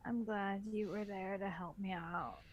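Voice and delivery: normal talking voice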